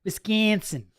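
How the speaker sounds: Wisconsin with a wide, flattened A, like "Wis-KEEAN-sin"; exaggerated Upper Midwest vowel on Wisconsin; Wisconsin accent. Shown as a demonstration. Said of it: "I'm from Wisconsin. I can say that".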